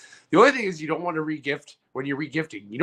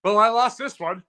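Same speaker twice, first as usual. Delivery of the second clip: laughing; 7 semitones higher, 10 dB louder than usual. Real, not acted. Laughing after losing.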